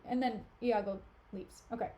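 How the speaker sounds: ordinary delivery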